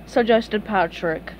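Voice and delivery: ordinary delivery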